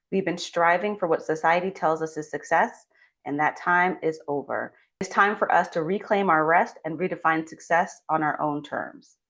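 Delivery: ordinary delivery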